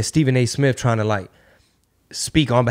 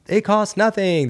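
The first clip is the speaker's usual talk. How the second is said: mock press secretary line; 8 semitones higher, slower than usual.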